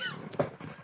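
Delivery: breathless high-pitched giggle; high squeal laugh. Real, not acted. Player cracks up.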